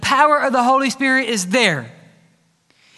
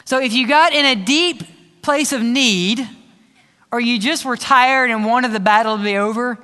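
ordinary delivery

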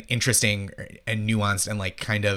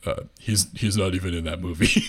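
low, deadpan comeback; dry, pitched-down imitation; John Benjamin deadpan; 4 semitones lower than usual. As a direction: imitate a comic staying totally deadpan to a child. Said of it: "totally deadpan in this little kid's face".

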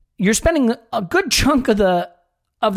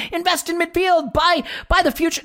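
lifted, higher sing-song voice; mock sensible plan; 10 semitones higher than usual. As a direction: voice the club's wishful thinking, a bit sarcastic. Mocking Arsenal's ideal transfer plan.

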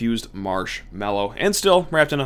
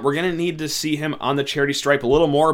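normal talking voice

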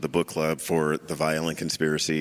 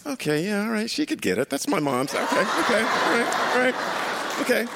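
high, fast, flustered okays. Retold or imitated. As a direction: babble it out, rattled and giggling. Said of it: "I'm like".

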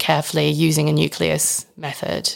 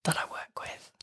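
whispering; 6 dB quieter than usual. Real, not acted. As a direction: whisper as if coworkers might overhear. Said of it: "Why are you whispering?".

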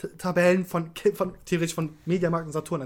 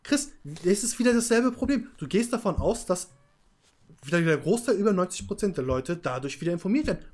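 usual speaking voice

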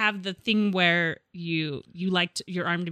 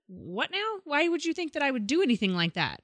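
baffled defensiveness; 6 semitones higher, 4 dB quieter than usual. Retold or imitated. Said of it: "a little bit of a".

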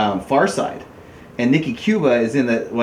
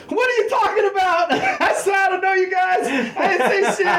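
very high, squeaky denial through laughter; imitating his panicked self, laughing; laughing mock protest. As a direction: cry innocent while cracking up.

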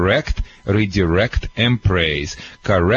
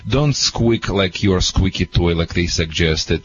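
ordinary delivery

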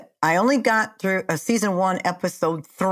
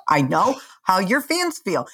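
ordinary talk

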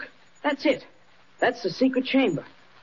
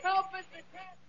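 high desperate cry for help; calling for help; 10 semitones higher, 4 dB quieter than usual. Acted. Trapped boy calls Mister Kent for help, desperate. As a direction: shout for rescue.

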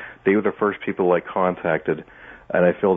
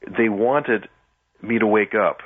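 usual speaking voice

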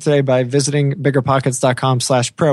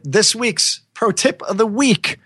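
announcer voice; 8 semitones higher, slower than usual. Pretend. Announcing segment.

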